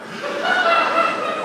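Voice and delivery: a high, loud burst of laughter; loud mocking laugh. Woman laughing loudly at the banker's haircut comparison.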